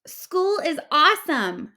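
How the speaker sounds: shouted. A narrator's reading. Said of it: "shouted Clark the shark".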